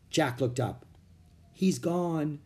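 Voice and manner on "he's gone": ordinary delivery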